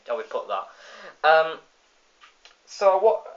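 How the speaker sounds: normal talking voice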